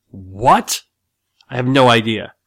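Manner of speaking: loud, affronted mock indignation; mildly acted angry cop, thrown by the question; exasperated retort in a cop's voice; baffled, enraged cop. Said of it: "kind of enraged by the whole question".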